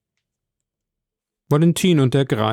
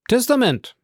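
ausgerufen; 11 semitones higher than usual. Acted. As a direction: call it out, struck by the discovery. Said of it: "rief der Notar". (laut und hoch ausgerufen)

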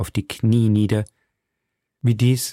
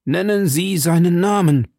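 flehender Felton; 7 semitones higher, 4 dB louder than usual. A narrator's reading. Felton fleht um den Namen.

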